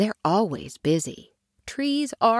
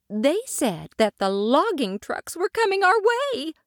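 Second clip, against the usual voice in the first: higher, worried mother tree telling tales; 7 semitones higher than usual. Acted. Mother warning her son, anxious and higher. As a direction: fret like an anxious old mother.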